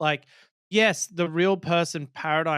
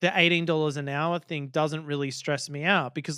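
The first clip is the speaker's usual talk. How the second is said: normal talking voice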